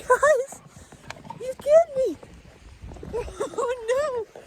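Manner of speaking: very high, playful cries of alarm; hammed-up high-pitched terror; mock panic. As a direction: pretend to be chased, squealing high. Pretends the dog is attacking.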